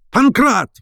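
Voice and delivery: high, urgent call; exasperated shout. A narrator's reading. Professor calling servant after phone call, exasperated shout. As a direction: yell the name at high pitch.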